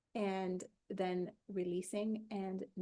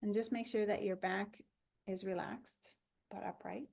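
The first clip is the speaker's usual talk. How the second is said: calm body-scan guide with long pauses; even, unhurried relaxation voice; guided meditation. Acted.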